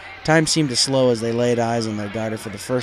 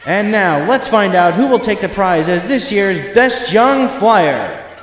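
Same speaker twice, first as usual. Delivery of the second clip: slow, booming announcer call, higher and much louder; stadium announcer; 7 semitones higher, 11 dB louder, slower than usual. A narrator's reading. Announcer welcomes the crowd, booming and slow. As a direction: boom it out over the amplifier like a showman.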